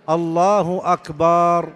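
أكبر with the hamza drawn out long, آكبر; long hamza in akbar. Shown as a demonstration. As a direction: stretch the opening a of أكبر into a long vowel. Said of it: "أن يمد الهمزة في أكبر". (مد الهمزة في أكبر)

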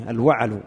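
"الوعل" as al-wa'al, vowel on the ع; corrected pronunciation. Shown as a demonstration. Teacher correcting the student reading aloud, says "الوعل" with fatha on the ع. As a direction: say "الوعل" with an "a" after the ع. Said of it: "بفتح العين".

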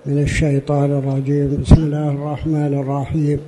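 formal sermon opening invocation; formal standard arabic. Real, not acted. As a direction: recite the opening praise formally. (افتتاحية خطبة بالفصحى)

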